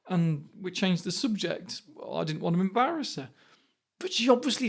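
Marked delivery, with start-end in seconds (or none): none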